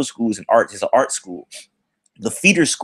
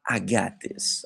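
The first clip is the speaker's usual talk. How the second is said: much softer, imitated quiet assurance; hushed cool boyfriend; 2 semitones lower, 9 dB quieter, much slower than usual. Boyfriend promising to fix it, hushed imitation. Boyfriend takes charge, retold.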